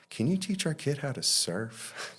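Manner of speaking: retold parent asking a favor; imitated parent's request.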